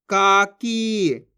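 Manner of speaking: काकी very slow, both vowels held; syllables drawn out. Shown as a demonstration. Teacher reads the word for learners, काकी drawn out. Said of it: "जिस तरीके से मैं खींच खींच के पढ़ रहा हूँ".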